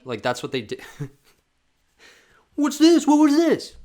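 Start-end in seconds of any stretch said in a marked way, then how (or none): 2.5-3.8 very high, loud mocking demand; mock shrill official. Vlogger mocking an official's outrage, high mocking squeal.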